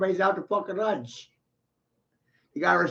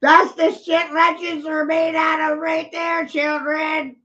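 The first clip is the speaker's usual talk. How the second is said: gleeful boast; 11 semitones higher, 4 dB louder than usual. Real, not acted. Boasting to viewers after laughing.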